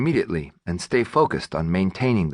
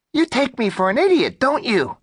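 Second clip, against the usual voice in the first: squeaky angry robber; 3 dB louder than usual. Acted. Robber yells at clerk.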